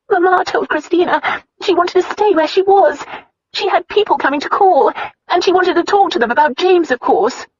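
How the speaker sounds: high, loud, crying voice; tearful. A narrator's reading. Sister explaining to her brother, high and tearful. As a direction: tell it through tears.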